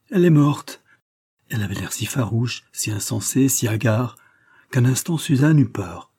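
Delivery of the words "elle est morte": higher, louder wail of despair; despairing cry. A narrator's reading. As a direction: let grief break out in a high cry. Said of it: "avec un geste de désespoir".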